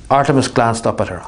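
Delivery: plain reading voice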